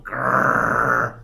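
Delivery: low rasping unvoiced growl; dog growl. Shown as a demonstration. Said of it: "they growl".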